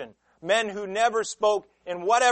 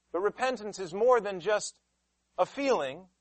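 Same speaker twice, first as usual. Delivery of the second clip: ordinary talk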